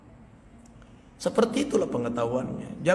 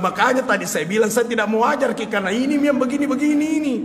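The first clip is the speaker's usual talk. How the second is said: higher, louder, fast protest; excited; 8 semitones higher, 4 dB louder, faster than usual. Retold or imitated. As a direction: rush the excuse out amused. Retold reply, amused. (membela diri dengan cepat dan keras)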